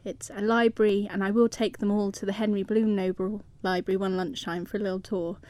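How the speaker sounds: ordinary talk, nothing marked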